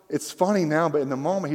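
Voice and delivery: ordinary talk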